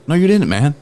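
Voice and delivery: playful high retort; teasing denial. Retold or imitated. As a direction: needle your buddy. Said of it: "And I'm like".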